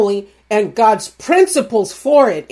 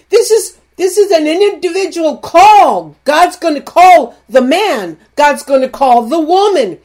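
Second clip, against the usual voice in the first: higher, louder, pushing hard; fired-up preaching; 4 semitones higher, 4 dB louder than usual. Real, not acted. Preacher scolding the doubting husband, loud and high. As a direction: preach it at full force. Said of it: "I don't know why I'm talking like this".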